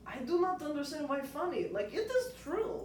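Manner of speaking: rough imitation of a Russian accent; bad Russian accent. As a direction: fake Eastern European Russian accent. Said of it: "I just do a shitty Russian accent, honestly, for my dad".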